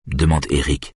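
usual speaking voice

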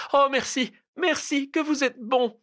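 very high-pitched, overwrought thanks; grateful cry. A narrator's reading. Cousin thanking him for his promise, crying out high. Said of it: "Elle s'écria".

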